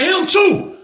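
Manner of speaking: high-pitched imitation of the booth lady; retold accusing witness. Booth lady points him out.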